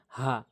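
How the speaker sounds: "হা" from the start of the throat. Shown as a demonstration. Teacher repeats the correct letter for learners, "হা" from the throat's start. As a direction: breathe "হা" out from the throat. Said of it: "হলকের শুরু থেকে হা বলতে হয়".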